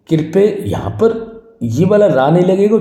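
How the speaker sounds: ordinary talk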